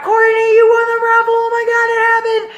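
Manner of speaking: high, fast squeal of congratulations; imitated excited crowd. Friends grabbing her over the win, excited squeal. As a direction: mimic frantic cheering, rushed and high.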